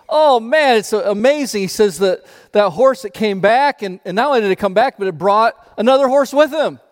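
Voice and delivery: high, bright burst of joy, loud; excited farmer. Acted. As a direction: gush over the news like a thrilled farmer. Farmer voiced excited in parable.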